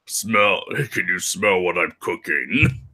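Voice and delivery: lightly voiced The Rock impression; mock The Rock's catchphrase; a hint of the wrestler's showman voice. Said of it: "he's like".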